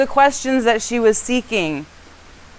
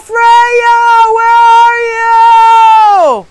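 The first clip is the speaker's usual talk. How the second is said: high, drawn-out searching call; hammed-up long wailing shout; screaming her name; 10 semitones higher, 10 dB louder than usual. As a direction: call out loudly into the distance. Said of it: "He's screaming her name into the ether".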